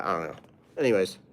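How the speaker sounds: usual speaking voice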